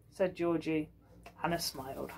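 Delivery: ordinary delivery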